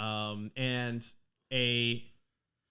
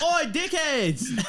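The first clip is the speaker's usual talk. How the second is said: loud Australian "Oi, dickheads!" pitched high; Australian accent; 14 semitones higher, 7 dB louder, slower than usual. Acted. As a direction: bark it out like a cheeky Aussie. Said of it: "That's Australian".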